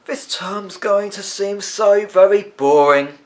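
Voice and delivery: a friend on the bus groaning about the dull term ahead; voiced school friend. A narrator's reading. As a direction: draw it out like a bored boy, lightly acted. Fidelio speaks in the story.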